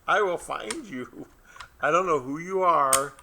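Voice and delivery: mock menace. Mock threat to strangers.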